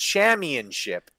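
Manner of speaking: "championship" said as cham-yun-ship; baffled mimicry, "championship" without its first P; mocked mispronunciation. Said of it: "do you not pronounce the first P in championship".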